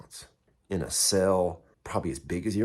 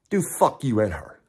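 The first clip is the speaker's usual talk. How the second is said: defiant answer; 4 semitones higher, 5 dB louder than usual. Retold or imitated. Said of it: "That's my fucking answer".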